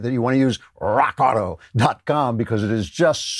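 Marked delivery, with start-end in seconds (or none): 0.8-2.4 "rock auto dot com" with ROCK rolled out dramatically; showy brand-name delivery. Shown as a demonstration. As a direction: relish "rock auto dot com", drawing out the first word. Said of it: "just by the way I say it".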